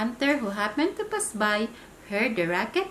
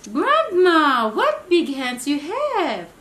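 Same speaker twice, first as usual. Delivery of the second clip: high, loud startled cry; a child suddenly frightened; girl alarmed by Granny's hands; 7 semitones higher, 9 dB louder, slower than usual. Acted.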